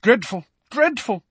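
high, self-important disapproval; pompous tutting tone. A narrator's reading. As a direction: deplore it with great pomposity. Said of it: "shaking his head pompously".

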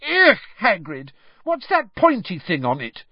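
high, loud revulsion; disgusted squeal. A narrator's reading. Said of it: "squealed Lavender Brown".

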